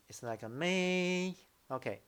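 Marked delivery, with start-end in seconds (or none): 0.5-1.3 "may" held high and level; drawn-out, high, even "may". Shown as a demonstration. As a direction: sustain "may" at the top of the range.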